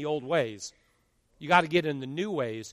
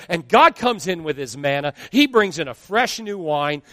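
normal talking voice

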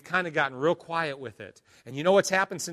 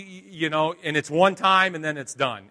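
ordinary delivery

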